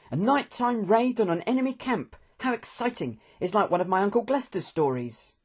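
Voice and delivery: high voice full of excitement; thrilled, high-pitched storybook youngster; eager young Hector. A narrator's reading. Hector thrilled about a night raid, excited.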